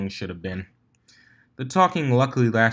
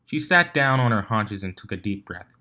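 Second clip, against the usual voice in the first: ordinary delivery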